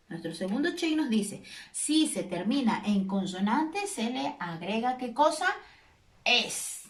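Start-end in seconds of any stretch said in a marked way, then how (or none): none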